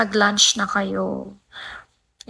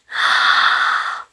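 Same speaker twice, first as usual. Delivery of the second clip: loud, drawn-out gasp; big audible intake of breath; amazed gasp; 4 dB louder than usual. Real, not acted. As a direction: suck in air in happy shock. Reacting to a viewer's gift.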